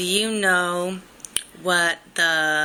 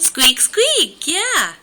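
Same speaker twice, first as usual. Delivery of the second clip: high, louder squeaky mouse voice; mouse squeak; 10 semitones higher, 5 dB louder than usual. Pretend. Mom repeats mouse sound.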